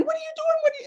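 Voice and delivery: high-pitched protest of a weeping mother; imitated crying. Crying mom to daughter shoving her in a closet, imitated tearful protest. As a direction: mimic a tearful, bewildered wail, high up. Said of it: "she's crying real hard".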